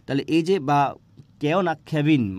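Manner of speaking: normal talking voice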